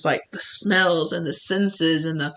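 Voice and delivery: normal talking voice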